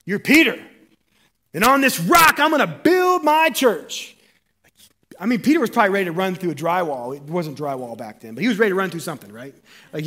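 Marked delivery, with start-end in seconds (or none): 0.1-4.2 high, loud and slow, booming with joy; excited Jesus. Acted. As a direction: announce it to Peter like the best news ever.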